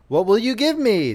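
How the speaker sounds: lightly voiced little man, high start; a droll little man greeting the girl; hobgoblin voice. Hobgoblin asks the weeping miller's daughter, lightly high voice. Dwarf's voice in story.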